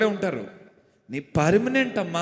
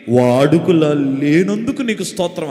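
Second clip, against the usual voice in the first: a loud, low, self-satisfied Pharisee praying; smug Pharisee prayer; 4 semitones lower, 8 dB louder than usual. Retold or imitated. Preacher mimics the Pharisee at the temple, smug and loud. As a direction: boom it out like a proud Pharisee thanking God.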